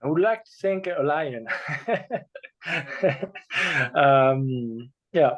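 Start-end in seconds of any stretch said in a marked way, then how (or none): 1.5-3.9 a short, real, amused laugh. Real, not acted. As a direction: laugh at your own answer. Reacts to his own lion answer.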